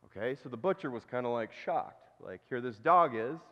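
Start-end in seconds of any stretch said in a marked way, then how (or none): none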